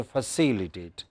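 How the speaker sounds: "facilitate" with a fuller first vowel, CI stressed; alternative pronunciation. Shown as a demonstration. Teacher allowing another way, alternative pronunciation. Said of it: "you can also say".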